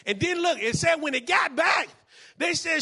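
loud, high shouted sermon climax; full-force preaching. Real, not acted. Pastor telling the prophets' story, shouted preaching. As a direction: build to the peak and shout it out.

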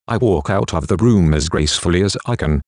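usual speaking voice

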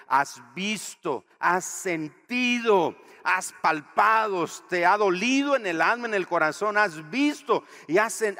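loud, high, impassioned sermon build; preaching at full force. Acted. Pastor pressing his congregation, loud and impassioned. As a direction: pour your heart into it.

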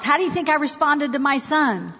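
ordinary talk

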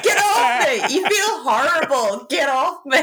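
high, loud mock disgust, laughing; hammed-up imitation of a revolted partner; mocking protest. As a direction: throw the complaint back, high and loud. Mocks partner's rejection in bed.

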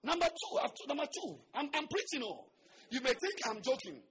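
ordinary delivery

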